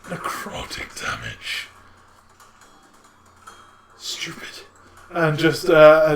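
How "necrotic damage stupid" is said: very low, slow, hushed undead rasp; voiceless, drawn-out creature growl; skeletal monster voice. Acted. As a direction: whisper it slowly as a menacing undead beast. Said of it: "it just kind of goes".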